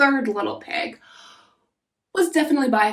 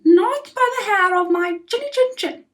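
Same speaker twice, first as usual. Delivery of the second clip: squeaky little pig, pitched well up; little pig calls through the door, high and defiant; 8 semitones higher, 3 dB louder than usual. Acted. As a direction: answer the wolf in a high piggy voice. Pig refuses wolf.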